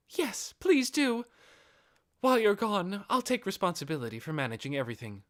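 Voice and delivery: a devoted wife-to-be taking charge; eager devotion. Acted. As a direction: accept the charge gladly, rushing the end. Albedo eagerly accepts duty.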